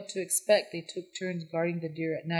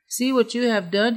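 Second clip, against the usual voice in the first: louder, much higher furious shout; angry shout; 6 semitones higher, 7 dB louder than usual. A narrator's reading. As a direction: shout at him for giving the meat away. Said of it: "he shouted".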